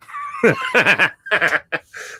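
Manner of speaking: explosive laugh at the beer; big laugh. Real, not acted. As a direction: let out a wild, whooping laugh. Bursts out laughing after tasting.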